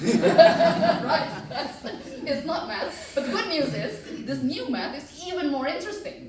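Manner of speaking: bubbling over about new math; excited. Real, not acted.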